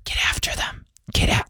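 mimicking someone whispering without a voice; whispered imitation of a lost voice; imitated whisper. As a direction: try to give orders in a mock voiceless whisper. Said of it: "I had a whisper".

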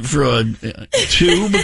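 ordinary talk, nothing marked

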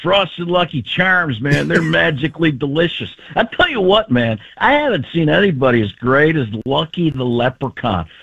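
excitable pitchman, leaping up in pitch; John Gruden. Acted. Coach pitching kids' cereal, animated impression. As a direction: rave about cereal, drawing out key words.

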